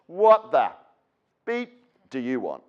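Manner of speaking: sharp snarl that drops slow; surly student. Retold or imitated. Scowling student snaps at the new teacher, a mild mock snarl.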